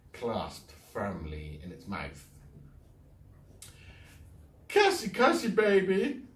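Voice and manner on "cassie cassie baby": high, loud sugary coo; honeyed. Acted. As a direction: call the cat sweetly like a baby. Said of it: "voice was thick and honeyed".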